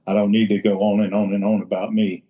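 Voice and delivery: ordinary delivery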